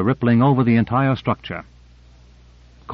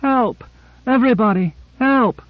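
high, shrill cry for help; 12 semitones higher than usual. A narrator's reading. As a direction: answer shrilly from afar, voice pitched way up. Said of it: "She answered shrilly".

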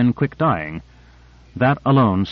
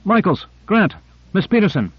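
calling out; 8 semitones higher than usual. A narrator's reading. Calling crew members by name.

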